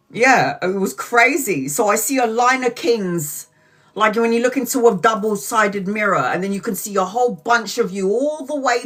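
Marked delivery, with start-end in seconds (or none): none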